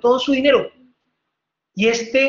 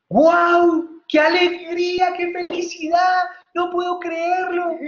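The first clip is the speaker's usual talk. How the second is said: high, slow, delighted gasp of joy; overjoyed exclamation; 8 semitones higher, slower than usual. Retold or imitated. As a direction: burst out with joy, drawn out and high. Disciple overjoyed at the letter.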